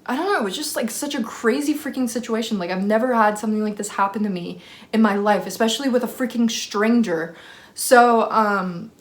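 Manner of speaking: plain reading voice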